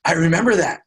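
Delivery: imitated youngster's animated recollection; excited kid. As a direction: blurt it like a kid suddenly remembering.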